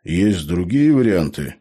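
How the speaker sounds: plain reading voice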